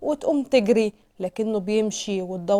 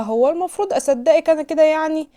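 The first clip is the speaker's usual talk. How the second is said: raised, louder mocking disbelief; scornful; 5 semitones higher, 7 dB louder than usual. A narrator's reading. Man laughing off her protest, mockingly incredulous. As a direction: scoff at her as if she's lying. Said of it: "يضحك عليها ويقول لها".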